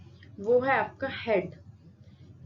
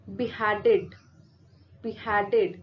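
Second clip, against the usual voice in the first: beheaded with the weight on the middle syllable, HEAD; stress on HEAD; 2 semitones lower, much slower than usual. Shown as a demonstration. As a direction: lean on HEAD in beheaded, light "be" and "ed". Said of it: "वो आपका है हेड".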